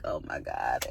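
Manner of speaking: breathless whisper under the breath; whispered dread. Retold or imitated. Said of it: "I'm just like".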